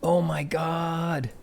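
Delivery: loud, amazed "whoa", then a slow stunned aside; stunned surprise. Real, not acted. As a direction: blown away by what he sees. Sees scary skeleton image.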